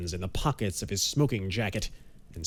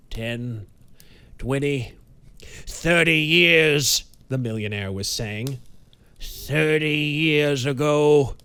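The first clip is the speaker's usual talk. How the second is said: a haunted man counting back the years; building anguish; 4 semitones higher, 5 dB louder, slower than usual. A narrator's reading. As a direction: swell from murmur to outcry. Banks lost in memory, building.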